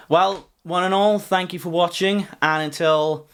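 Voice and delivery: high, loud show outro voice; announcer sign-off. Acted. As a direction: announce the goodbye. Sign-off catchphrase.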